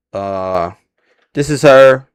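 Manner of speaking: normal talking voice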